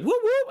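very high mock siren whoop; police siren whoop. Retelling the cop pulling him over, high siren whoop. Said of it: "he was like".

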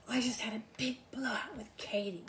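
hushed gossip whisper; retelling a housemate gossiping in a whisper. Retold or imitated. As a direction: whisper it like a secret at a sleepover. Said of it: "they're whispering".

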